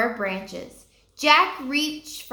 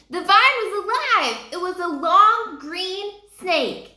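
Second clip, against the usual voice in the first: usual speaking voice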